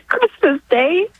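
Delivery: high, halting voice on the edge of crying; real emotional, tearful. Real, not acted. Caller recalling bringing her baby home, tearfully emotional. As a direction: overcome with grateful tears, pausing between phrases. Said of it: "I wasn't expecting to get so emotional".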